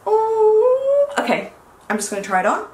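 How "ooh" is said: usual speaking voice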